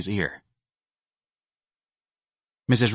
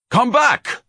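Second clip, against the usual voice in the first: much higher and louder, a full roar; thundering shout; 6 dB louder than usual. A narrator's reading. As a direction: roar it after her as she leaves. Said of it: "Ignatius thundered".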